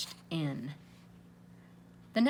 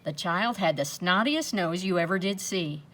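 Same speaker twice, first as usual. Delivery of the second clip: usual speaking voice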